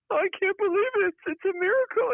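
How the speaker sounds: very high, hammed-up weepy voice; mock crying anchor. Cohost mocking a CNN anchor crying over the result, high mock weeping. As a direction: squeak it out like a weeping newscaster. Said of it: "he was actually crying, saying".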